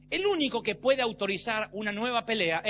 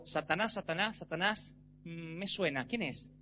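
"Satanás" murmurado más suave, como si no recordara; despiste fingido; 4 semitones lower, 8 dB quieter than usual. Retold or imitated. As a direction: se hace el distraído. Schuller finge no conocerlo. (Schuller fingiendo no recordar a Satanás, suave)

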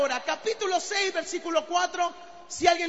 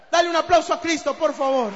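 calling out to the whole congregation; rally the crowd, loud; loud call to applaud Christ; 7 dB louder than usual. Real, not acted.

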